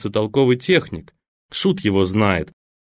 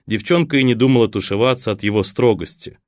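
ordinary delivery, nothing marked

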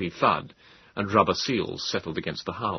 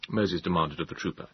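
ordinary delivery, nothing marked

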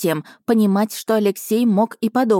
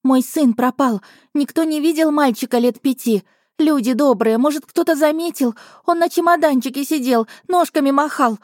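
frantic cries; 7 semitones higher than usual. Acted. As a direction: beg the crowd in a panic. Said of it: "с криками".

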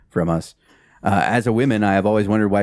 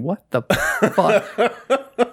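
very slow, higher laughing disbelief; baffled laugh; 12 semitones higher, much slower than usual. Retold or imitated. Imitating a viewer stopping the video, baffled. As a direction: stretch it out, laughing. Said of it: "just going".

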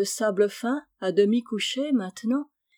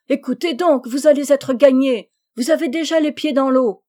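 calling out; 5 semitones higher, 9 dB louder than usual. A narrator's reading. Chabre calling out from cliff.

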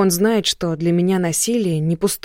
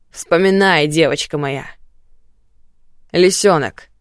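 угрожающе; 5 dB louder than usual. Acted. Муж угрожает жене.

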